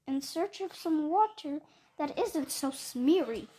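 loud indignant accusation; angry Lorax. Acted. Lorax rails at the Once-ler over the glop, loud and indignant. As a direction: rail at him for the pollution.

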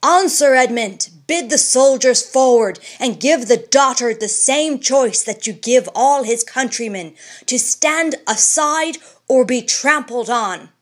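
loud, high angry orders; fierce urging. Acted. Randolph urging the governor to march, loud and fierce. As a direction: push the governor to trample him. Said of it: "cried Edward Randolph fiercely".

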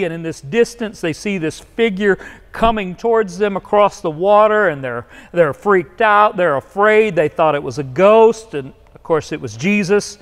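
normal talking voice

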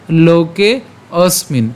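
"লোকে অস্মিন" slow, a break between the words; broken-up pronunciation. Shown as a demonstration. Teacher revisits the broken-up verse, slow "লোকে অস্মিন". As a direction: say "লোকে অস্মিন" split and drawn out. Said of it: "খেয়াল করুন উচ্চারণটা কেমন হচ্ছে".

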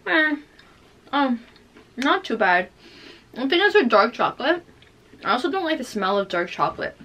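talking between bites; chewing while talking. Real, not acted. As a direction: mouth full of chocolate. Seen 1: Bites chocolate at -5 and +7; chews while talking throughout.

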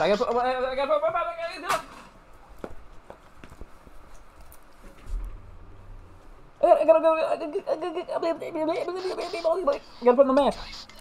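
laughing, stammering cartoon-pig voice; Porky Pig stutter. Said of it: "Come on, Porky Pig".

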